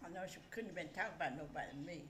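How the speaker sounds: usual speaking voice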